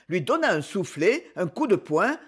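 plain reading voice

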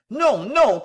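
high, loud protest; crying out. A narrator's reading. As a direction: cry out in urgent denial. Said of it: "cria monsieur Pickwick".

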